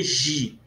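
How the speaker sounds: Gi said as "ji", like the start of girafa; soft G, wrong form. Shown as a demonstration. Teacher showing what Hebrew never has, Gi with a soft zh. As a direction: soften Gi to a French-style zh sound. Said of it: "Nunca vai ter Gi".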